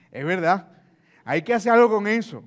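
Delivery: energetic yes; eager agreement. Shown as a demonstration. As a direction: snap into keen agreement. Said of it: "No, Juan le dice".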